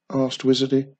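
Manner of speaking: plain reading voice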